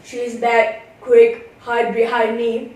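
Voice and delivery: low, urgent owl; a hint of a hushed warning. A narrator's reading. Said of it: "she heard the owl shout".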